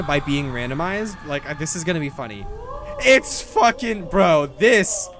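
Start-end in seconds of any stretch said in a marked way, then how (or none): 3.0-5.1 higher and louder, fired up for the fight; hyped shout. Real, not acted. Streamer hyping chat for the boss, loud and excited. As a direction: yell it out as the boss starts.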